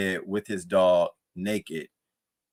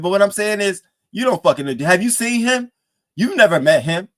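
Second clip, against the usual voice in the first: louder, higher, building indignation; heated rant; 9 semitones higher, 6 dB louder than usual. Real, not acted. Streamer ranting at [name] for cussing out [name], heated. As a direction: tear into him for disrespecting her.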